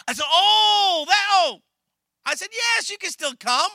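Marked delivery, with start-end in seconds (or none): 0.3-1.6 high, loud startled "oh"; loud flustered oh of surprise; surprised realization. Retold or imitated. 2.5-3.7 high, loud eager reply; loud, eager yes; eager welcome. Retold or imitated. Pastor welcomes dancer.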